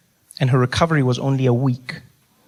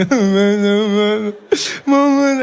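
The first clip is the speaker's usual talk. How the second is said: high, loud hammed-up wail; mock crying; 5 semitones higher, 4 dB louder than usual. Grown son at mother's bedside, mock crying. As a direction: wail like a big baby, put on. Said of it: "So I'm crying for everything".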